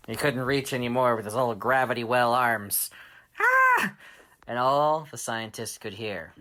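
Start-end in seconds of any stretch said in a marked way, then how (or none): 3.4-3.9 loud, high scream; villain's frustrated shriek. Acted. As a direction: shriek as you strain and fail to reach. Planet X screams, out of reach.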